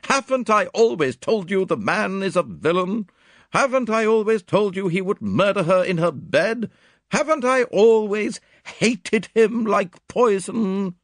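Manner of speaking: loud, high, emphatic outburst; indignant cry. A narrator's reading. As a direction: vehement high-pitched protest. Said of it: "cried Miss Howard".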